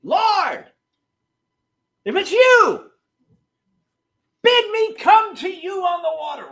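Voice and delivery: high, loud call over the wind; calling across a storm. Retold or imitated. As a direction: shout to be heard across the storm. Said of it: "He calls out across the storm".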